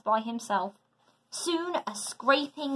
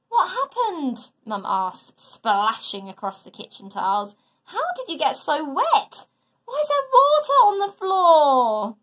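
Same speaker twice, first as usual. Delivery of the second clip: startled mum; 5 semitones higher, 4 dB louder than usual. A narrator's reading. Mum finds flooded kitchen.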